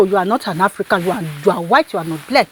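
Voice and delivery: ordinary talk, nothing marked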